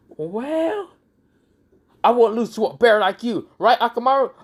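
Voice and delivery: high-pitched acted bravado; cocky challenge. Kiba challenging a bear beside Akamaru, cocky. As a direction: boast defiantly, pitched well up.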